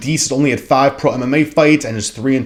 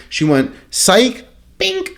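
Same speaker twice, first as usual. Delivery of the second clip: mock fight taunt; 13 semitones higher, 4 dB louder, slower than usual. Acting out the knockout punch.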